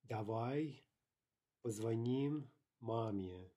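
slow "Давай", pause, then drawn-out "позвоним маме"; word by word. Shown as a demonstration. Teacher modelling how to suggest calling mom, slow word by word.

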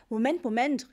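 loud, urgent interjection; calling out. A narrator's reading. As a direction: call out to halt everyone. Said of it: "rief Papa". (laut gerufen)

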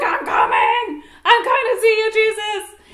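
very loud, whooping call; excited shout. Retold or imitated. Peter swims toward Jesus on shore, shouting excitedly. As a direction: holler it out with hammed-up excitement. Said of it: "he's so excited".

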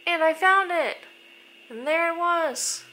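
high, loud triumphant announcement. Real, not acted. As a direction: proclaim the discovery in a ringing high voice. Triumph at finding the place.

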